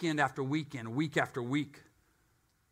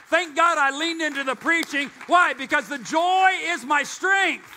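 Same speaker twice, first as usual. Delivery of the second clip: rising, louder preacher's build to a shout; fiery preaching; 6 semitones higher, 5 dB louder than usual. Acted. Preacher rousing the congregation, building to a shout. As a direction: preach it up to full force.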